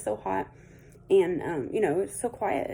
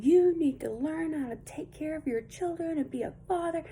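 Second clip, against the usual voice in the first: higher imitated yell at the husband; mock screaming; 8 semitones higher than usual. Imitating the neighbor wife yelling at her husband, high mock yell. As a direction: retell her scolding in a raised mock shriek. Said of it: "And she starts screaming".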